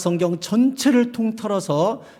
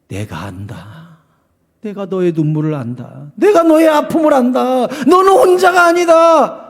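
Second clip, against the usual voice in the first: comforting God's voice; 4 semitones higher, 4 dB louder than usual. Acted. God answering the sufferer.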